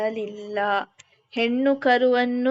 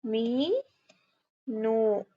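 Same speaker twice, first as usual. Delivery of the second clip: ಮೀನು drawn out very slowly; ಮೀನು said very slowly, syllable by syllable; slow dictation; 2 semitones lower, 4 dB quieter, much slower than usual. Shown as a demonstration. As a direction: stretch ಮೀನು out for the class to write. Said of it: "ಓದಿ ಬರಿಬೇಕು".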